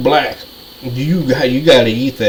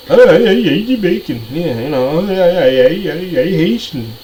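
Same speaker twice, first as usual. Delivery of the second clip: higher, sing-song mock of a denier; 5 semitones higher than usual. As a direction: raise the voice and mimic them dismissively. Mocking people claiming other origins.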